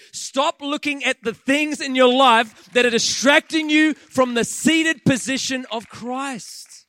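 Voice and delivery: loud, slow preaching push; full-force sermon exhortation. Acted. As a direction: drive the command home from the pulpit. Urgent exhortation to congregation.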